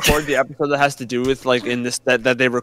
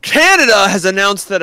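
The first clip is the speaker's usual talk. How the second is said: loud, higher news-anchor read; loud announcer delivery; 6 semitones higher, 13 dB louder than usual. Retold or imitated. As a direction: bellow it like a TV announcer. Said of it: "He'd, he'd deliver it like".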